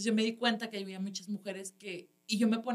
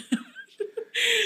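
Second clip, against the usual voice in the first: high burst of laughter; 5 dB louder than usual. Real, not acted. Laughing at the story.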